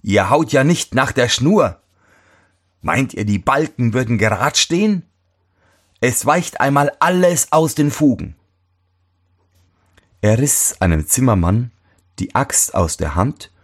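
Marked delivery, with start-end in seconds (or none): 0.0-8.4 calling out. A narrator's reading. Cobbler finds fault with the carpenters, mildly calling out. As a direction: mildly call out a complaint. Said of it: "rief er".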